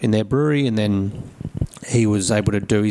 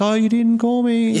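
much higher squeaky self; high mock self; 13 semitones higher than usual. Brewer imagining his reply to the owner, high. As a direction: play your naive self high. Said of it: "And I'd be like".

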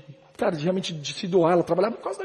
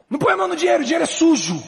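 high-pitched, louder imitation of a scolding parent; mock-scold a child in a raised, high voice; 10 semitones higher, 4 dB louder, faster than usual. Parent telling a child off for touching money, imitated scolding.

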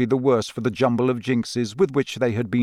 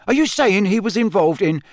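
sharp questioning, pitched up; 8 semitones higher, 4 dB louder than usual. A narrator's reading. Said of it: "said Harry sharply".

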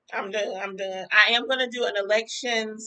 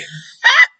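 laughing shriek; 6 semitones lower, 13 dB louder than usual. Real, not acted. Laughing at her own rant.

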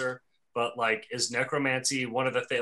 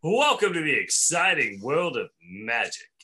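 textbook welcome. Acted. Textbook greeting young magic pupils, hammed-up presenter. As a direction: sell it like a cheesy educational intro. Voicing a cheesy textbook intro.

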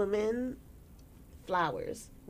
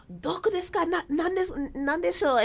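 Japanese tourist; 10 semitones higher than usual. Retold or imitated. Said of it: "some guy was like".

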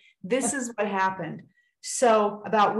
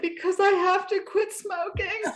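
much higher, laughing through tears; laughing while crying; 11 semitones higher than usual. Real, not acted. Answering the doctor through tears, laughing. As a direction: blurt it out half laughing.

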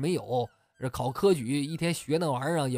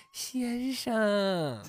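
a soft, lingering, loving call; a hint of an affectionate lady's call; tender call; 6 semitones higher, 3 dB quieter than usual. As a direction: melt him with one tender word. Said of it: "含情脉脉地喊了一句".

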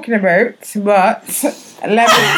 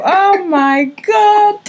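slow high surprise; 11 semitones higher, much slower than usual. Real, not acted. Laughing reaction.

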